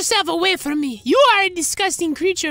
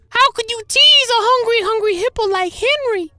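mocking whine; 9 semitones higher, 4 dB louder than usual. Mocking tease for Henry.

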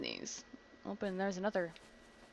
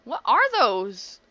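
even louder and slower, stretched out in disbelief; louder disgusted question; 9 dB louder, much slower than usual. Real, not acted. Asking again about the weird aliens, louder and appalled. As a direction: push the repeat up in horrified disbelief.

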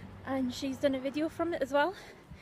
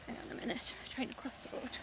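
quiet aside; 10 dB quieter, faster than usual. Real, not acted. Crossing road, aside.

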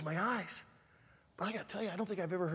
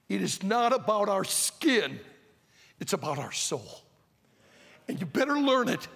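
louder, higher fiery preaching; impassioned sermon build; preaching at full force; 6 semitones higher, 10 dB louder than usual. Acted. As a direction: drive it home to the youth. Preaching climax.

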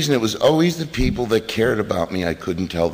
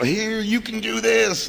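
high, slow, drawn-out cheerleading; mock pep talk, pitched way up; showy encouragement; 12 semitones higher than usual. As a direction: hand it over with gushing, put-on encouragement. Hands newcomers a chip, showing off.

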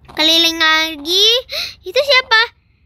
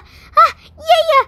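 crying; 8 semitones higher, 4 dB louder, slower than usual. Acted. Child crying over old memory.

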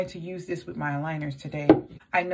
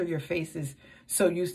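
slightly muffled by hand; speaking behind hand. Real, not acted. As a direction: hand cupped over mouth. Seen 0: Hand cupped over mouth while demonstrating, lowered by 1.5.

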